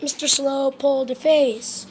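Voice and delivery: plain reading voice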